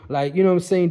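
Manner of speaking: ordinary talk, nothing marked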